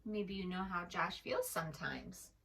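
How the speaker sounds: usual speaking voice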